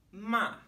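"Ma" high and loud, dropping sharply; falling fourth tone. Shown as a demonstration. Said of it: "starts high in our register and falls".

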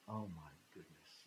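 low, hushed aside to himself; inward thought. Retold or imitated. Cornered traveler thinking to himself, hushed dismay. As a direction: think it aloud, very softly. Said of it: "And I'm thinking".

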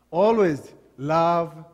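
slow emphatic plea; drawn-out emphasis. Acted. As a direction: lean hard on "Always love". Pastoral exhortation to love.

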